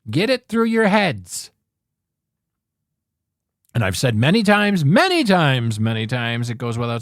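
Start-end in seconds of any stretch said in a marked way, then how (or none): none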